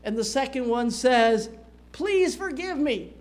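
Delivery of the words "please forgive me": pleading servant. Acted. Servant begs in parable.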